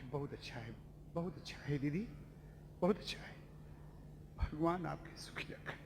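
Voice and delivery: soft, hushed, almost whispered; a broken bride blessing them under her breath; quiet resigned plea. Acted. Bound bride to her sister-in-law, hushed resigned blessing.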